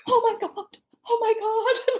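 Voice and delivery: very high, squeaky mock terror; retold panic. New hunter reliving cougar tracks, squeaky mock panic. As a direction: voice shooting up in a hammed-up fright. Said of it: "And I'm like".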